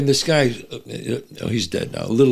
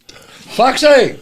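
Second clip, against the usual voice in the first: calling out; 10 semitones higher, 7 dB louder than usual. Retold or imitated. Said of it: "He goes".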